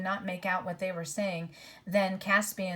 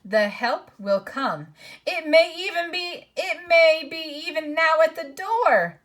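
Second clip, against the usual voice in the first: character voice; 9 semitones higher, 10 dB louder than usual. A narrator's reading. Character voice in read story.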